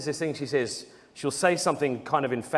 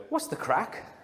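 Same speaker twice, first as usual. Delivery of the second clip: Irish greeting; 6 semitones higher, 4 dB quieter, slower than usual. Retold or imitated. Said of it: "And so they go".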